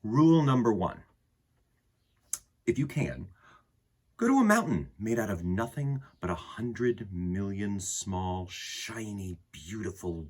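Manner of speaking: put-on gentle read-aloud to children; storybook reading. Reads picture book aloud.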